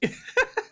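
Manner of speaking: sudden high-pitched laugh; high burst of laughter. Real, not acted. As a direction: let out a squeaky laugh. Reacting to clip, laughs.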